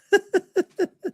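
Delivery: a squeaky high-pitched laugh; high giggle. Real, not acted. Streamer cracks up at his own Paul joke, high giggle. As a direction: burst into a tiny high giggle.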